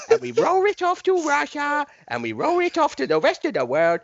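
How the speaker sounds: put-on squeaky high Chinese accent; over-the-top Chinese accent. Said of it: "I may do it in a Chinese accent".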